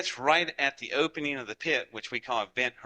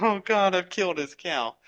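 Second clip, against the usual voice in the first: dismayed; 7 semitones higher, 5 dB louder than usual. Retold or imitated. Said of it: "so I'm sitting there going".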